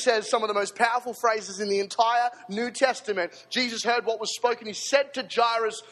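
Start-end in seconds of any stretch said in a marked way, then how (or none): none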